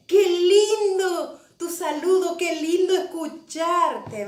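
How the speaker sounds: slow, drawn-out delight at hearing someone; imitating a loving greeting. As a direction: voice the pastor's affectionate welcome, lingering on the words. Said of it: "Con un amor como siempre".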